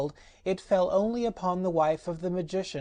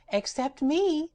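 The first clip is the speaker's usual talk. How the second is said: very high, slow and merry; Patchwork Girl; 10 semitones higher, slower than usual. Acted. Rag doll boasting while whirling, very high and merry. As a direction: chirp it proudly. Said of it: "said the Patchwork Girl".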